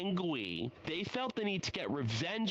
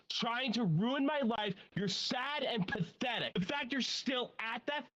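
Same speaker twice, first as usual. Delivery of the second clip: indignant rant; 9 semitones higher than usual. Real, not acted. Ranting at ex-manager.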